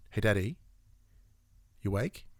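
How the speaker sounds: put-on soft, low child's wake-up question; lightly voiced kid, quietly asking; imitated child waking dad. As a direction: gently check if dad is awake. Child waking a sleeping parent.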